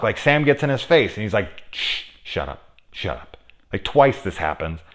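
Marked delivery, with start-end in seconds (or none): 1.7-3.4 hissed shh, then shut up kept low; imitating a quiet shushing hush; mock hush to silence him; imitated hush. Imitating Sam hushing Dan.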